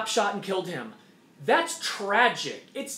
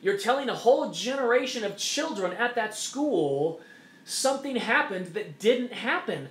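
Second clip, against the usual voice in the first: ordinary talk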